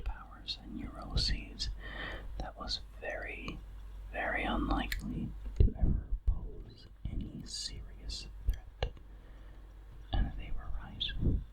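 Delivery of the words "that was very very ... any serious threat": ordinary delivery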